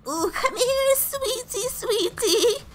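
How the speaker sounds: high-pitched cooing fairy godmother voice; odd fairy godmother. Acted. Strange godmother beckons the girl, high-pitched cooing. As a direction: coo it in a high put-on voice. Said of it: "why are you talking like this".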